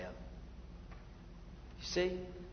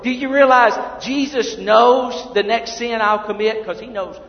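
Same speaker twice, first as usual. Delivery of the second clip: louder, higher, fervent preacher; preaching at full force; 7 semitones higher, 13 dB louder than usual. Acted. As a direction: drive each line home to the congregation.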